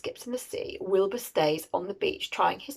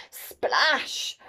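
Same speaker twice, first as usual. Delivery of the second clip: drawn-out, higher-pitched splash word; exclaimed sound effect; 5 semitones higher than usual. A narrator's reading. Narrator as the broomstick lands in water, exclaiming. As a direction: burst it out like water crashing.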